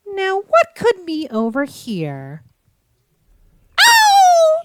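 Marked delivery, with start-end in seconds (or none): 3.8-4.6 sudden high startled "Oh!"; surprised yelp. Real, not acted. Sudden discovery.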